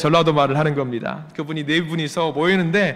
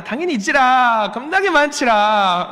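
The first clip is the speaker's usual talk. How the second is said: higher, slow Jeolla drawl on 많지라; Jeolla dialect; 7 semitones higher, slower than usual. Retold or imitated. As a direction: answer in a homely Jeolla dialect. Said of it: "전라도 말로". (느긋한 전라도 사투리 흉내)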